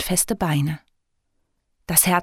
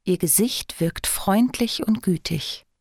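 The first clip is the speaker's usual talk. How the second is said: ordinary delivery, nothing marked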